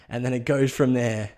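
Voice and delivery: usual speaking voice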